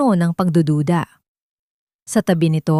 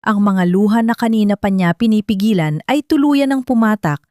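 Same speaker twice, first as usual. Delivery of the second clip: plain reading voice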